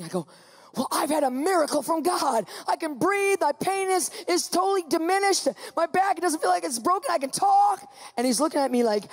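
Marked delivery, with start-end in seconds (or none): 0.8-7.9 high, elated rush of good news; excited testimony. Retold or imitated. Patient telling the ambulance driver of a miracle, excited. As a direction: announce it with rising, amazed joy. Retold joy at sudden healing.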